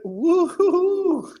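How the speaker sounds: long, high drawn-out woo-hoo; playful whoop. Pretend. Getting baked to eat pizza.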